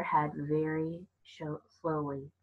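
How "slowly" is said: ordinary delivery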